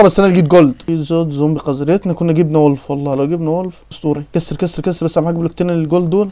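ordinary talk

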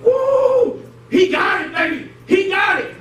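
high, hyped-up cheering. Acted. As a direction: whoop it up like a proud fan. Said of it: "I'm sure Jesus was hyped up".

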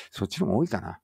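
usual speaking voice

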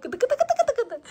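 high, playful tickling coo. Real, not acted. Mother tickling the baby. (bebeği gıdıklarken ince, şakacı ses)

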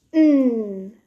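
N as a long hummed nnn; phonics sound demo. Shown as a demonstration. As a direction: hold "N" as a nasal consonant, not a letter name. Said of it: "Should we do those again".

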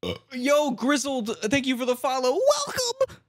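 hohe, laute Danke-Stimme an Follower; energetic streamer thanks. Real, not acted. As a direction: hype the new follower with a high, bright voice.

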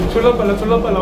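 loud laughing exclaim. Real, not acted. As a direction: laugh through it, thrilled by the speed. Laughing at car's speed. (웃으며 크게 외치기)

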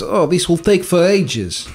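high, slow mock Eastern European line; mock Eastern European accent. Dad imitating his son in a mock accent. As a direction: ham up the son's odd phrasing. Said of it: "he goes".

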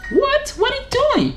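squeaky-high mock outburst; hammed-up high-pitched "what?"; mock disbelief. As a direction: leap way up high in pretend shock.

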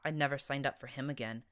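ordinary delivery, nothing marked

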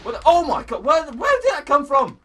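loud startled cry. Real, not acted. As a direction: blurt in shock. Ambushed by fireball.

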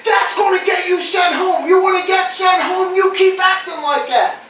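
high-pitched imitated telling-off; mock angry scolding. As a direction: hammer out the warning like an irate boss. Retells the chef's angry warning.

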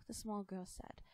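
ordinary delivery, nothing marked

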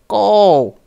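put-on high, loud little baby cry of go; the baby joins in. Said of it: "said the baby".

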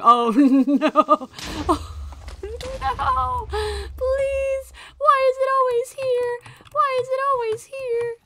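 high, loud panicked pleading; panicked wail. Real, not acted. Gamer wails at being teleported again. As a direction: wail in real panic, half laughing. Panicking in game, repeated pleas.

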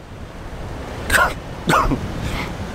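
hard choking cough; coughing up a swallowed fly; just swallowed a fly. Real, not acted. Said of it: "I think I just swallowed a fly".

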